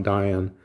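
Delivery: Dion said as DIE-on; alternative pronunciation. Shown as a demonstration. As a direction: back to Dion with a long I.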